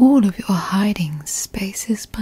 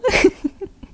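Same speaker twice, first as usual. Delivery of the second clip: a short, sinister little laugh; creepy giggle; 10 semitones higher than usual. Acted. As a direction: giggle with gleeful menace at finding them. Finds the hiding partner.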